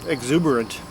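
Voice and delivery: ordinary delivery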